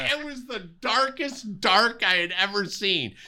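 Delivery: high, words bubbling with laughter; real laughter in the voice; laughing through it. Real, not acted. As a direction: speak while still cracking up. Still laughing from the story.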